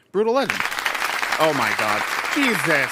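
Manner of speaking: jolted by a sudden scare; jump scared. Real, not acted. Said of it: "Not only did you jump scare me".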